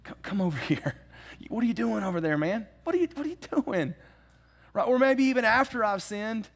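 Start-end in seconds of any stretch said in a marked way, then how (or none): 0.1-3.9 gently teasing call. Retold or imitated. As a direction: call a friend back with a grin. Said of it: "the Holy Spirit will say".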